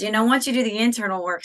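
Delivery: ordinary talk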